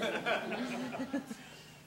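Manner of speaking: short embarrassed laugh; nervous laugh. Acted. Narrator laughing at her teenage fluster, embarrassed. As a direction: laugh at your own panic.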